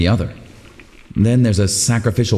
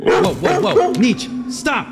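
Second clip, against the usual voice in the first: high, startled whoa calling the dog to stop; alarmed call to dog; 12 semitones higher, slower than usual. Acted. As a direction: yank the dog back in sudden alarm.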